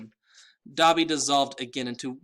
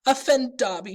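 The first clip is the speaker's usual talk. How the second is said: choked up; 12 semitones higher, 7 dB louder than usual. A narrator's reading. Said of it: "choked the elf".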